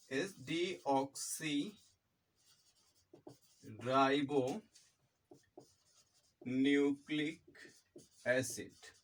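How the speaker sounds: very slow, broken into parts with pauses; slow dictation. Shown as a demonstration. Teacher giving students the full form of DNA, slow dictation. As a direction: dictate the term piece by piece.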